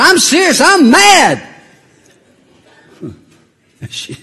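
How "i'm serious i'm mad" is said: louder, higher mock fury; mock anger. Grandfather telling the wife he's mad, loud hammed-up anger. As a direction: insist on being mad, hammed up. Insisting he is angry.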